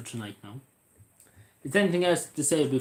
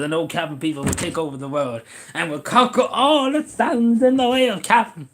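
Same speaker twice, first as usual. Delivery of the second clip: higher and louder, a grand mock decree of conquest; hammed-up megalomaniac rallying cry; mock villain proclamation; 5 semitones higher, 6 dB louder than usual. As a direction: declare it like a cartoon conqueror. Mock grand Cavan conquest speech.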